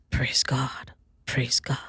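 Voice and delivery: soft whispered repetition; whispered praise. Retold or imitated. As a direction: whisper it to yourself reverently. Pastor murmuring praise to himself.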